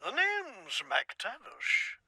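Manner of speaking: slow, soft try at a Glasgow accent; quietly testing a Scottish accent line; practised Scottish accent. Shown as a demonstration. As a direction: rehearse a Scottish intro, slowly. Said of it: "Shit, that's not it".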